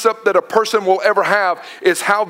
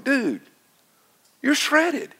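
high, amazed dude, you're shredded; impressed dude; 6 semitones higher, 5 dB quieter, slower than usual. Retold or imitated. Dad impressed by son's body fat, amazed. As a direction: marvel at his son.

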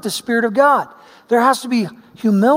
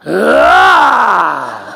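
playful scare yell; 7 dB louder than usual. Retold or imitated. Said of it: "squeeze real hard and go".

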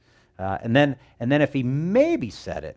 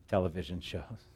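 normal talking voice

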